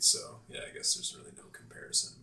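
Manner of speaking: whispered, quick aside; whispering. Real, not acted. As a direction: drop to a whisper. Trails off unvoiced.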